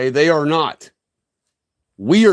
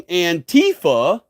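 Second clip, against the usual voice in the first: Antifa as "an-TEE-fa", middle syllable raised; wrong syllable stressed; slower than usual. Retold or imitated. Speaker quotes listeners' mistake, Antifa stressed an-TEE-fa. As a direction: put the weight on TEE in Antifa. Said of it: "putting the wrong emphasis".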